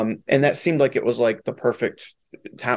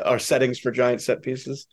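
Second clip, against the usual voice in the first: ordinary talk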